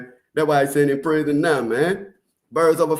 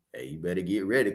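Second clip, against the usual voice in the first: usual speaking voice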